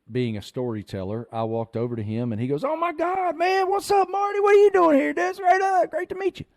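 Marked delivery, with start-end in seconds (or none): 2.6-6.5 high-pitched, gushing hammed-up greeting; excited fan greeting. Man at the tournament greets the storyteller, excited and high-pitched. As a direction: squeal it like a starstruck fan.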